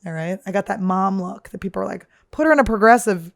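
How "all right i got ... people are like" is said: usual speaking voice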